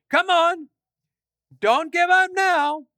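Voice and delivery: high, loud mocking jeer; mocking taunt. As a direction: taunt the prophets, egging them on. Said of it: "Elijah mocked them".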